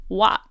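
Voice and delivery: mock the co-host's "What"; imitating co-host's pronunciation. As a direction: say "What" the way the co-host says it. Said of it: "and you say".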